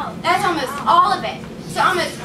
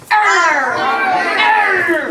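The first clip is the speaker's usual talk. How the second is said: loud pirate "Arr"; pirate growl; 4 dB louder than usual. Acted. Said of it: "A pirate goes".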